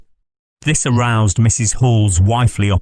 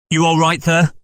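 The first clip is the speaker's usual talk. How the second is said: raised, higher call through a door; 8 semitones higher than usual. Acted. Innkeeper calling through the parlour door, raised and higher. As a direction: call out to whoever is inside. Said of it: "asked Hall, rapping".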